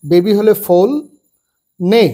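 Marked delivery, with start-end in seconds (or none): 1.8-2.0 নে said as plain "nay", no gh sound; silent gh. Shown as a demonstration. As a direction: say নে with the gh left silent, no "negh". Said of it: "জিএইচ এর নেঘ এরকম উচ্চারণ হবে না". (gh উচ্চারণ না করে শুধু নে বলা)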